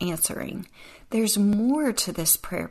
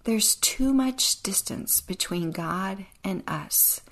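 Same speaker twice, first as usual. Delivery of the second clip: usual speaking voice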